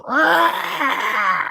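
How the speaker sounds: loud, high-pitched mock hawk screech; imitated hawk screech. Imitating the hawk that yelled at them, piercing screech. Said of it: "like, real fucking loud".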